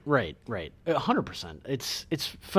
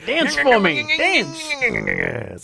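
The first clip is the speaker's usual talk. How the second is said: menacing villain; 8 semitones higher, 5 dB louder, much slower than usual. Pretend. Mock villain demand.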